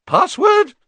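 high, loud startled portrait voice; a woken portrait, squeaking high and loud; high loud fat lady woken from a doze; sleepy fat lady. Acted. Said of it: "said the fat lady".

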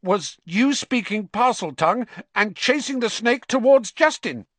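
high, loud accusation with nerves underneath; stubborn. A narrator's reading. Ernie accusing Harry of chasing the snake, stubborn. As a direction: accuse him and refuse to back down. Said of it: "said Ernie stubbornly".

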